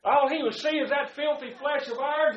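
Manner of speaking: loud, high preacher fervor; fervent preaching. Acted. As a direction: preach grace with full force. Preaching at full force.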